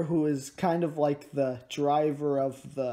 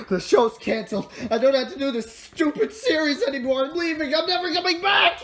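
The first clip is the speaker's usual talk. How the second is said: high, loud, climbing shout; mock quitting outburst; 12 semitones higher, 6 dB louder, faster than usual. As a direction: build to a hammed-up exultant yell. Pretends to quit the series.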